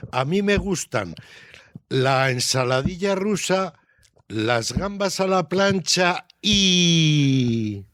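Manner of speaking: final "y" stretched out long, left hanging; "y" en suspenso. Shown as a demonstration. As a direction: list the dishes with pauses, then hold "y" in suspense. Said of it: "ahí queda la esperanza de qué". ("y" alargada, dejando la frase en suspenso)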